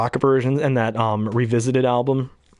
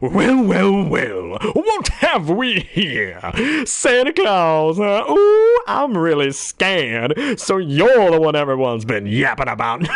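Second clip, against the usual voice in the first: higher, louder gloating villain voice; mocking bogeyman sneering at Santa; Oogie Boogie; 4 semitones higher, 3 dB louder, slower than usual. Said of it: "I'm the Oogie Boogie Man".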